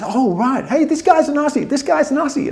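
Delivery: very high-pitched mock American excitement; excited American. American telling the others about the Aussie, excited mimicry. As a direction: announce it to everyone, pitched right up.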